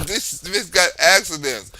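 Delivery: usual speaking voice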